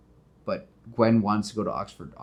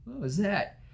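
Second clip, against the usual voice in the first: startled guard; 11 semitones higher than usual. Retold or imitated. Guards hear a noise.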